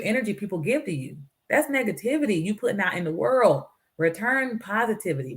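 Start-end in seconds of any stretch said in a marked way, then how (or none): none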